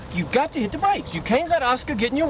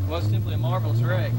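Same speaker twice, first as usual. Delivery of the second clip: low mock golfer voice; 13 semitones lower than usual.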